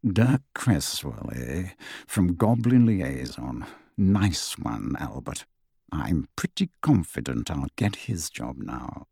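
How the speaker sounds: low, slow, leering mutter. Acted. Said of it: "leering, and muttered".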